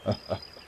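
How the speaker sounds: a little breath of happy laughter; old man's airless laugh; breathy laugh. Acted. Father's joyful laugh.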